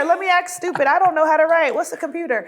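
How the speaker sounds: loud, high, hammed-up pleading; mock helplessness. Cast member acting stupid to get writers' help, loud mock helplessness. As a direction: play dumb at the top of your voice.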